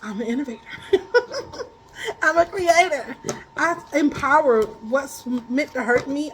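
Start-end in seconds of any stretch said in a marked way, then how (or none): 0.7-1.6 a short high real laugh; laughing at her own bold claim. Real, not acted. Laughing after calling herself an innovator.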